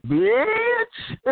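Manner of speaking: very high, shrill-pitched squeal; squealed insult. Retold or imitated. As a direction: shriek the word way up high. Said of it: "I'll be like".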